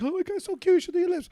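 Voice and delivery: put-on high cooing girlfriend voice. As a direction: coo it in a high girly voice.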